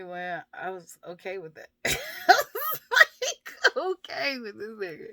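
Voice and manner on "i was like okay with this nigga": words squeezed through high, loud laughter; laughing. Real, not acted. Woman admitting she was okay with him, laughing. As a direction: crack up at your own confession. Laughing at her own past choice.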